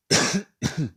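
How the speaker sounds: coughing fit after swallowing water wrong; sudden choking cough on water; choking on a drink. Real, not acted. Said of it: "je m'étouffe avec mon eau". (toux d'étouffement avec de l'eau)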